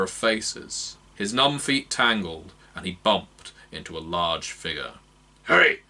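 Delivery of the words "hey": high, loud gruff growl. Acted. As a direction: bark it out at the stranger. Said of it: "the figure growled".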